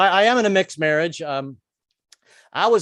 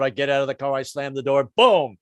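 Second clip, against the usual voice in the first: ordinary delivery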